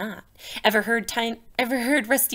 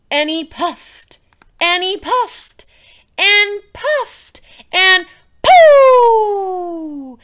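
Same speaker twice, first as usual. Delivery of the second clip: slow, rising and louder to a burst; building puff; 5 semitones higher, 11 dB louder, much slower than usual. A narrator's reading. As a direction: swell bigger each time until it pops. Frog puffs up and explodes.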